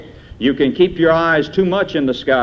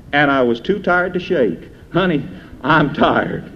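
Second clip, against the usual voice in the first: recited poem; 4 semitones higher than usual. Acted. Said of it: "Somebody wrote".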